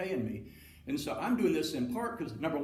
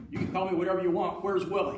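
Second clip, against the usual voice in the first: loud, fast, higher imitated coach; brusque demand; 5 semitones higher, 6 dB louder than usual. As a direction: cut in as the displeased coach. Knight confronts the radio hosts.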